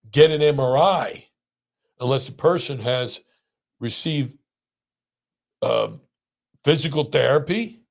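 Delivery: normal talking voice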